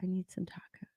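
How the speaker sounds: whispered craving; soft hungry whisper. Real, not acted. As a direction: breathe it out in a whisper. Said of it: "repeated as a whisper".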